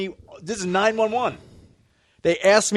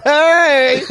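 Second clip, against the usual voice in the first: loud, high drawn-out shout; hammed-up "hey"; mock album catchphrase; 6 semitones higher, 7 dB louder than usual. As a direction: belt it like a comedy-album track. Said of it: "I love track four".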